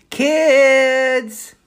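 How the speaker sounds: high, loud calling out; shout from the stairs. A narrator's reading. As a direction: call the kids from downstairs. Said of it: "A shout came from the stairs".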